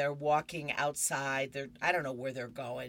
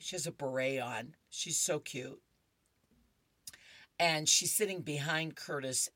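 ordinary delivery

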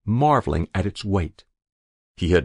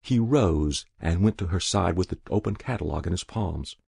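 ordinary delivery, nothing marked

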